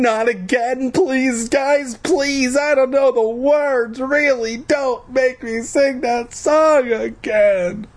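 very high, whiny begging; begging and pleading. A narrator's reading. Boy begs bullies not to make him sing, high whiny pleading. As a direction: plead frantically in a squealing high voice. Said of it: "I begged and pleaded".

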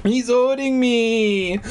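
put-on high, slow little-girl whine; crying out. Little girl complaining of the dummy's grip, crying out. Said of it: "Alicia cried".

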